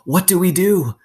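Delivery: high, loud panicked question. Acted. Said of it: "asked Mister Pendanski".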